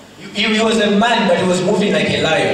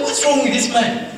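indignant protest; 7 semitones higher than usual. Retold or imitated. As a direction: vent your frustration at him. Indignant retold protest to God.

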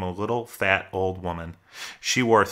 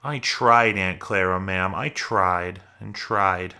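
ordinary delivery, nothing marked

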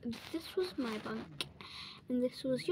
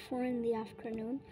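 ordinary talk

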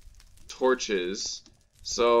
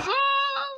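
startled high yelp. Real, not acted. Sudden cry while leaving.

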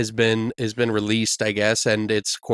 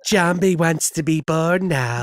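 put-on slow, higher creepy child voice; creepy kid line; 5 semitones higher, slower than usual. Host echoing the creepy child line as a spooky toast. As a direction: drawl it like the eerie movie kid. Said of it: "repeats creepy kid line".

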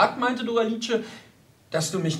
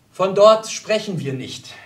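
firm interruption; 2 semitones higher, 6 dB louder than usual. Acted. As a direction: cut in firmly, louder and slow. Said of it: "unterbrach Hans".

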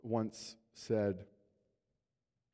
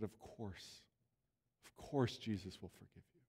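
softer, quiet comfort; gentle reassurance; 2 semitones lower, 8 dB quieter than usual. Retold or imitated. Pastor reassuring the guilt-stricken believer, softly comforting. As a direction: soothe him like a forgiving pastor. Pastor forgiving the repentant man.

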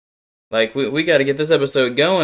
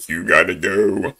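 low, deeper take on "You gotta go."; imitating that guy; 7 semitones lower than usual. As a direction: mimic the guy in shorts, lower. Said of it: "that guy in the shorts".

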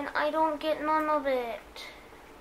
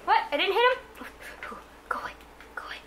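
ordinary delivery

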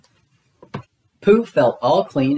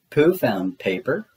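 ordinary delivery, nothing marked

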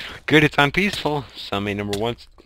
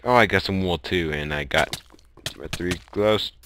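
ordinary talk

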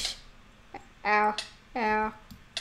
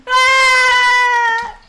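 startled cry; 6 semitones higher, 4 dB louder than usual. Real, not acted. Startled by glitch.

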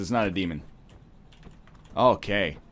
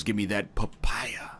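slow breathy whisper; 6 semitones lower, 7 dB quieter, slower than usual. Pretend. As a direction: whisper it secretly. Whispered aside.

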